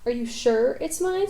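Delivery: ordinary delivery, nothing marked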